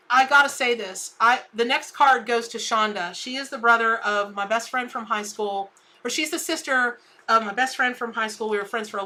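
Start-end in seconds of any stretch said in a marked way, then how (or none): none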